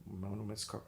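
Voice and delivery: low soft one missed call; hushed phone readout. Shown as a demonstration. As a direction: read it off like a phone voice. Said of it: "So I go".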